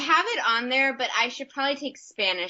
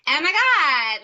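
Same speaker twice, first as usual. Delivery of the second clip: put-on high, swooping valley girl exclamation; 5 semitones higher, 6 dB louder, slower than usual. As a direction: gush it like a California valley girl. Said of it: "they're kinda like".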